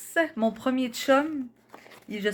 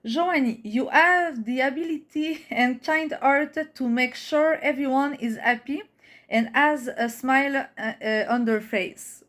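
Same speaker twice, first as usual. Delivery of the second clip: English read slowly with a Québécois accent; careful reading in French-accented English; Québécois-accented English, drawn-out words; French-Canadian accent; slower than usual. Real, not acted. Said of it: "Jugez pas mon accent".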